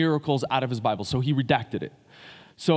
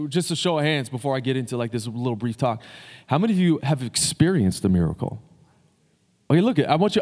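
normal talking voice

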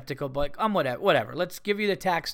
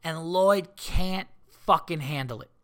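ordinary talk, nothing marked

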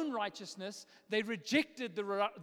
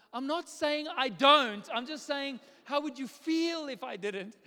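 flustered protest; 5 semitones higher, 7 dB louder than usual. Retold or imitated. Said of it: "I was like".

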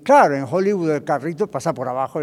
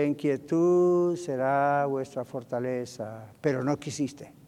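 slow, soft, lowered recitation; quiet scripture quote; 3 semitones lower, 5 dB quieter, slower than usual. Acted. Preacher quoting the Bible on quietness, hushed. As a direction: let the verse settle in calmly.

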